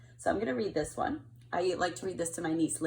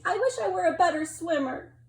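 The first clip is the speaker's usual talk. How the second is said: higher, louder wistful little-creature voice; a small sea creature sighing for more; little narwhal wishing; 9 semitones higher, 6 dB louder than usual. Acted. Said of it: "he's saying".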